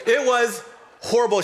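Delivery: emphatic. Real, not acted. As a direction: belt out "It was horrible".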